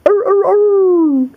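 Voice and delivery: high, loud slow howls; hammed-up high howls; coyote howl. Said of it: "go howling".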